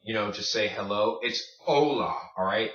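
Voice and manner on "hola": hola with a silent H, starting on the o; correct hola. Shown as a demonstration. Teacher corrects students, hola with silent H. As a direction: drop the H from hola. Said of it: "is silent".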